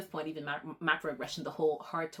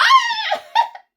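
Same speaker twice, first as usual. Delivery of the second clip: sudden high giggle, much louder; high burst of laughter; 16 dB louder than usual. Real, not acted. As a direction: break into a loud high laugh. Bursts out laughing mid-gush.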